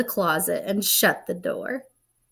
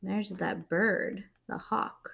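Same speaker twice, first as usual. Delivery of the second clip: whisper; 2 semitones lower, 11 dB quieter than usual. Real, not acted. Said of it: "whispering to children".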